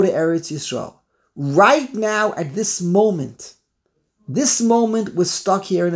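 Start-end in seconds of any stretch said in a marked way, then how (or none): none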